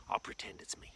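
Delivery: ordinary delivery, nothing marked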